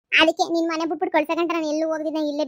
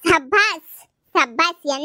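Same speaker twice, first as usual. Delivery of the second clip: sarcastic bravo; 5 dB louder, much slower than usual. Acted.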